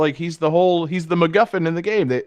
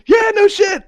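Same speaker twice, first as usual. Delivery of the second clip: excited; 6 dB louder than usual. Real, not acted. Emphatic agreement in banter.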